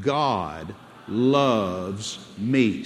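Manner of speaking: slow, spaced-out declaration, word by word; say it out loud. Shown as a demonstration. Preacher leading the congregation to repeat, slow declaration. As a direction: lead the crowd through it very slowly. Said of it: "everybody say it out loud".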